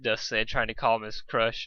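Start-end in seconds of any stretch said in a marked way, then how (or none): none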